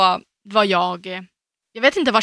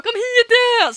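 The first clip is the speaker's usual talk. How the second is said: high, loud delighted cry; excited call; 5 dB louder than usual. Retold or imitated. Sick friend shouting for her to come over, overjoyed. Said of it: "Hon bara".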